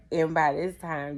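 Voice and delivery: ordinary delivery, nothing marked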